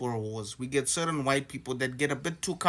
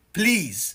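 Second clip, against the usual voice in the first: louder, higher pleading please; a raised, insistent appeal; emphatic plea; 7 semitones higher, 6 dB louder than usual. Real, not acted. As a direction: press the plea home with force.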